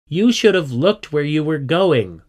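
loud, high-pitched comeback; retorting. A narrator's reading. Squiddy answering Tony's accusation, retorting indignantly. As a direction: throw the blame straight back. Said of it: "retorted Squiddy".

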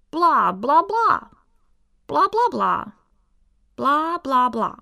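high, loud gleeful nonsense; alien babble. Acted. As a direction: babble away in alien gibberish. Aliens babble while scribbling.

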